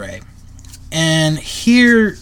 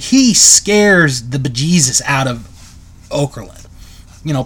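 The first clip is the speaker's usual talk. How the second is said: usual speaking voice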